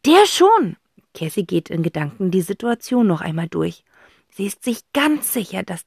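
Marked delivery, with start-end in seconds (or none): 0.1-0.8 put-on girl voice firm. Cassie retorts to Zack.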